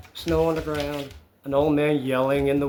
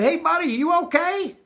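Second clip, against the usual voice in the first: high, concerned call-out; retold calling out; 11 semitones higher, 3 dB louder than usual. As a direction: re-enact hailing someone who may be hurt. Calling to the man in the retelling.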